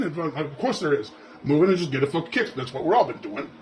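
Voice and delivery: ordinary delivery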